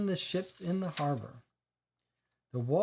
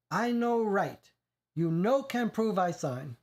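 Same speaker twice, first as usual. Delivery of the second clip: put-on higher, slow, heavy Italian accent in panic; shrill, stronger accent; 4 semitones higher, slower than usual. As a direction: push the Italian accent harder, scared. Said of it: "His voice was shrill and the accent much more noticeable".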